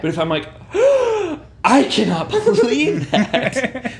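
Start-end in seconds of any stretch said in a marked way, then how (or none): 0.7-3.9 high, slow, gasping disbelief; dramatic gasp. Retold or imitated. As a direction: ham up shocked disbelief. Imitating attention-seeking gasp.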